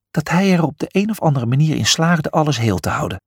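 ordinary delivery

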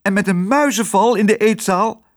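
high, heated protest at an absurd claim; indignant yell. A narrator's reading. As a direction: argue back in an outraged high voice. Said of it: "schreeuwde Lodewijk". (verontwaardigd schreeuwend)

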